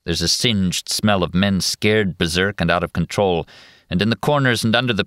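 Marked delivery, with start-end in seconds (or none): none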